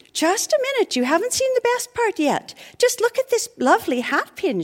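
put-on high, excited girlish exclaim. A narrator's reading. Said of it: "she exclaimed".

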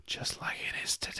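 whisper. Acted. Conspiratorial aside.

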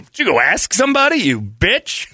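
high, loud accusing wife. Acted. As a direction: catch him in the lie. Acted wife accusing husband.

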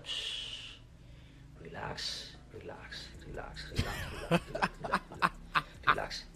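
soft airy whisper with a chuckle; whispered relax. Shown as a demonstration. Calming himself down on camera, whispering with a laugh. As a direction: hush him with a laughing whisper. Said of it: "el que tiene la razón no ofende".

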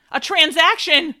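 the sister shrieks at father: a high, loud shriek. Acted. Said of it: "she shrieked".

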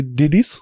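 "diddies" with D sounds replacing the T's; "diddies", every T turned into a D; T's as D's. Shown as a demonstration. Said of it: "replace T's with D's".

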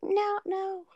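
high mimicked kid refusal; imitating daughter. Mom quoting daughter rejecting the explanation, high mimicking voice. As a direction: put on a high child's voice to refuse. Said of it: "she's like".

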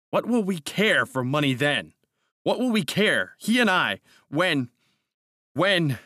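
fervent outburst. A narrator's reading. Narrator carried away before Hawberk, fervent and agitated. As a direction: get carried away, then catch on the last word.

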